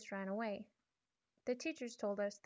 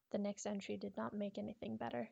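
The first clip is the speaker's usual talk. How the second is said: ordinary delivery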